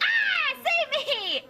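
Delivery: hammed-up panicked plea; mock scared shriek. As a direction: imitate the frightened crowd. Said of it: "And they were like".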